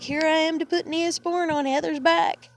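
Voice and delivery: high-pitched mock proclamation; mocking announcement. Mocking mother arriving to doctor Heather, sarcastic announcement.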